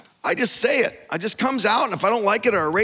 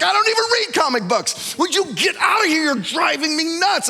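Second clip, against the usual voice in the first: mock exasperated wife; 8 semitones higher than usual.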